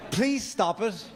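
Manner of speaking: ordinary talk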